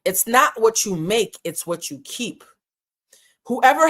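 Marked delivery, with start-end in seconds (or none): 0.0-2.4 slow, weighty repeat of the saying; slow preacher-style emphasis; slow, deliberate maxim. Shown as a demonstration. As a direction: let each word land like a lesson. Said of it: "Think about that".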